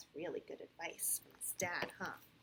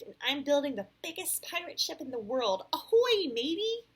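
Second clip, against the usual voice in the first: bragging; 9 semitones higher, 14 dB louder than usual. A narrator's reading. Alex boasts of his pirate ship, bragging loudly. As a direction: boast building to a loud, high hail. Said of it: "Alex bragged".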